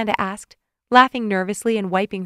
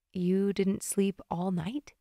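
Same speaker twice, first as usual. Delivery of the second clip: soft, slow intimate question; tender; 9 dB quieter, much slower than usual. A narrator's reading. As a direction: ask it gently, close to him. Tender moment, arms around his neck.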